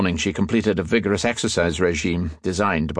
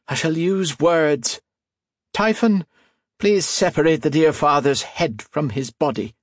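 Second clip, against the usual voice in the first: scornful reedy Irish man; 8 semitones higher than usual. A narrator's reading. Lime scolds his brute and orders a killing, high and sneering.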